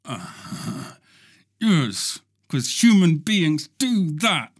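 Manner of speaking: ordinary talk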